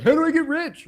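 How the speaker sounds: high, loud mock of a stupid question; mocking dumb question. Speaker ridicules his own question to the billionaire, loud mockery. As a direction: blurt it out, making fun of yourself. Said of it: "most stupid question".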